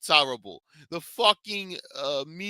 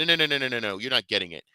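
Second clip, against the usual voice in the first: usual speaking voice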